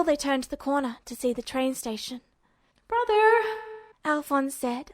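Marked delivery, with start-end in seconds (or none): 2.9-3.4 put-on higher, boyish younger-brother voice; Alphonse's voice. Alphonse calls to his brother, higher boyish voice. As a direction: call out to your older brother, higher.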